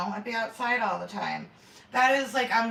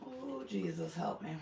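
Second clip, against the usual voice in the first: mock prayer under breath; 10 dB quieter than usual. Real, not acted. Bracing for the taste.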